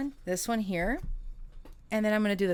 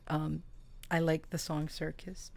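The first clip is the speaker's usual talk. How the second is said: ordinary talk, nothing marked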